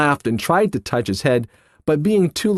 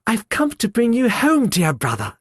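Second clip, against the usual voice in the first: put-on much higher, eager child voice; a small sister bursting with joy; little girl; 8 semitones higher than usual. Fan to her brother, gleeful child voice. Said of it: "clapping her tiny hands".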